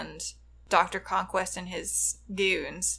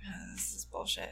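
very soft, under the breath; muttered grumble; 4 semitones lower, 17 dB quieter than usual. Retold or imitated. Retelling the Doctor's reluctant cameo, muttered grumble. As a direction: mutter it as a retold aside.

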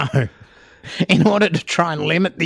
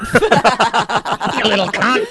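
slow, higher, hammed-up growing threat; mock angry threat; 6 semitones higher, slower than usual. Santa threatening the teenager, mock angry. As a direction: stretch it out like a comic brawler.

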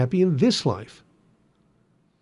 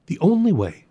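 only punched high; the only way, hit only hard; stress on only; 5 semitones higher than usual. Shown as a demonstration. Contrast after one way, best way.

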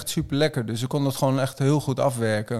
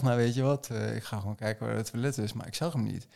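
hushed; 3 semitones lower, 7 dB quieter than usual. Retold or imitated. Sneaking to find toilet.

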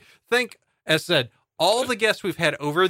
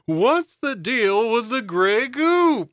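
Seinfeld impression; 8 semitones higher, 3 dB louder, much slower than usual. Seinfeld bit parody.

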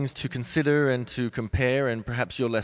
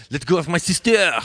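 put-on "Let go of my sister!" shouted higher and louder in a French accent; 8 semitones higher, 9 dB louder than usual. Dancer mimicking a French colleague's line in a French accent. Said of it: "but being French, he'd be like".

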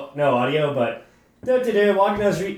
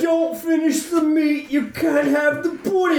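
high, hammed-up cockney bark; over-the-top cockney; 6 semitones higher than usual. Improvising a British line for friends, in exaggerated cockney. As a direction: push the fake cockney to the max. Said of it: "fucking cockney to, like, level ten".

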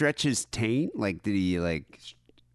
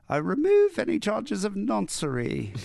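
higher, put-on regal voice; mock Queen; 10 semitones higher than usual. Mocking the Queen with a lofty decree voice. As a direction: pronounce it like a royal decree.

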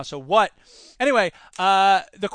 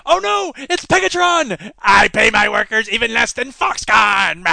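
loud, booming cartoon villain boast; 2 semitones higher, 8 dB louder than usual. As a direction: ham it up like an eighties cartoon baddie. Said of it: "villain in a Transformers cartoon".

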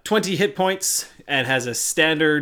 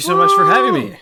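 comic high voice; 9 dB louder, faster than usual. Acted. Justin greeted, comic high voice.

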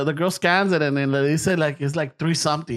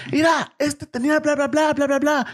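higher, put-on babbling voice; cashier's complaint; 7 semitones higher than usual. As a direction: rattle it off like a fussy clerk.

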